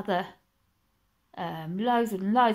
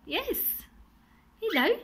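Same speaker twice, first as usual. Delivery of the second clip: talking to a pet bird; 12 semitones higher than usual. Real, not acted. Greeting the cockatiel in cage.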